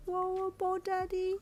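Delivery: put-on high sleepy little girl; drowsy toddler asking. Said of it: "Ko asked sleepily".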